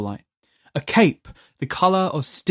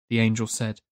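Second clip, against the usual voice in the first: usual speaking voice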